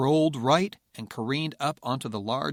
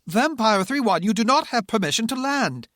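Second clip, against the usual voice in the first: higher, loud agitated British shout; 11 semitones higher, 7 dB louder than usual. Acted. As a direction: shout it out, accent thickening. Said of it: "the controller shouted".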